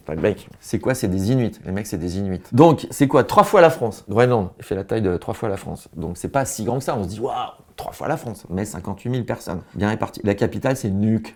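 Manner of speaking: normal talking voice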